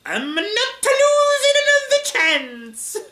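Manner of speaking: put-on very high, loud Italian-accented wail; soaring, drawn-out Italian accent; Ghidorah. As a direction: declare it slowly in a hammy Italian accent. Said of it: "doing an Italian accent for Ghidorah".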